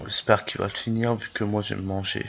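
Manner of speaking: normal talking voice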